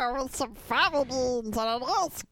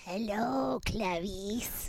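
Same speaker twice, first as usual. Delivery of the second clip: soft, whispered Hello, Clarice; whispered mock Hannibal greeting; Hannibal Lecter impression; 3 semitones lower, 5 dB quieter, slower than usual. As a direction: breathe it like a creepy killer. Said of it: "what is that voice?".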